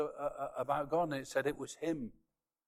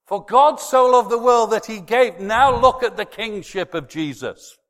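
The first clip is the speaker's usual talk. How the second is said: shouted, much higher and louder, slow; preaching at full force; 8 semitones higher, 15 dB louder than usual. Real, not acted. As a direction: preach it out with everything you have. Continued loud preaching.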